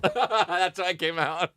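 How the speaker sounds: words through laughter; laughing while talking. Real, not acted. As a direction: talk while laughing slowly.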